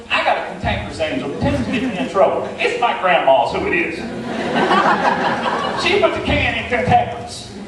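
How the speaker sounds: ordinary talk, nothing marked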